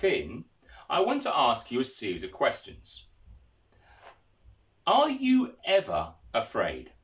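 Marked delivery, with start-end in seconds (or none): none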